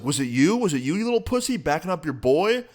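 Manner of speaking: acting out the big guy getting in his face; mock angry accusation. Big guy accusing the narrator, imitated furious taunt. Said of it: "the guy comes up to me now and he's like".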